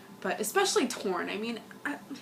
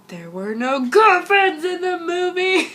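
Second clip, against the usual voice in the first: loud, high, slow cheering yell; excited shout; 9 semitones higher, 13 dB louder, slower than usual. Real, not acted. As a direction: shout it with glee. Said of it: "that sounded even more weird 'cause I'm sick".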